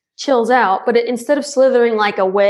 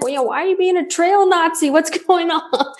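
high, fast laughing question; amused teasing; 6 semitones higher than usual. Retold or imitated. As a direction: tease her, laughing. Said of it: "Chainsaw was like".

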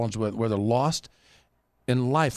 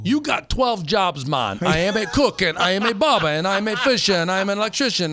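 high, loud comic rant; Damon Wayans impression; 7 semitones higher, 4 dB louder than usual. Host teasing a guest who juggles jobs, loud high comic impression. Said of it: "Damon Wayans".

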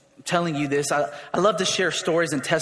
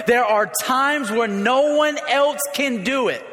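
a fiery call to rise; preaching at full force; 4 semitones higher than usual. Acted.

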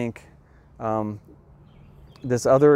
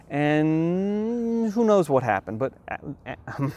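fighting back tears as it slips out; getting emotional; 4 semitones higher, slower than usual. Real, not acted. Lecturer choking up over the aborted child, real emotion. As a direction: voice catching, slow and choked up. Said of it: "so I'm getting emotional again".

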